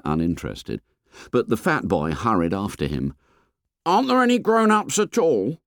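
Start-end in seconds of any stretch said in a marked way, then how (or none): 3.9-5.6 put-on fat boy voice. A narrator's reading. Fat boy complains to the fair boy, high and whiny. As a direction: complain like a chubby schoolboy.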